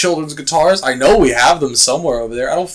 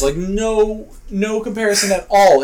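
normal talking voice

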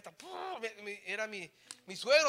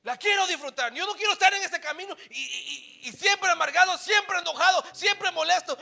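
loud, high, passionate declaration; fervent preaching shout; 9 semitones higher, 14 dB louder than usual. Real, not acted. Preacher declaring to the church he wants to enjoy his walk, shouting. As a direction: proclaim it with all your heart. Climax of sermon.

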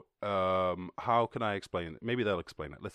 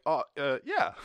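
sheepish mock reply; 4 semitones higher than usual. Humans answering sheepishly.

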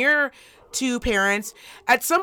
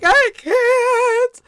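high, loud whine; mock tearful wail; 9 dB louder than usual. Mom imitates daughter refusing to dress, mock wailing. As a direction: stretch it into a hammed-up wail. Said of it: "she's just got, like, tears".

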